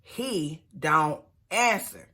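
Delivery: "He don't answer" dragged out very slowly; drawn out "He don't answer" after the third call. Shown as a demonstration. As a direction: stretch "He don't answer" for drama. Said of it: "She call him one more time".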